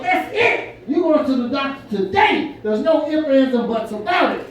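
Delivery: high, loud mock tirade from the wife; angry wife. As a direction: lay down the law, furious. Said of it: "She hit the ceiling".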